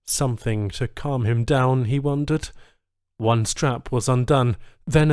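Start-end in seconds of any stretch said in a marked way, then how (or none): none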